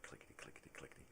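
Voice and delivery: very soft, voiceless patter; hushed backspacing noise; imitated typewriter clicks. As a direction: whisper the clicking like tiny keys. Imitates typewriter going back.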